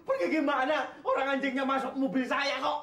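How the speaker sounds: high-pitched, exasperated complaint; upset protest. Acted. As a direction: protest with the voice pushed up. Upset boss, dog in his car.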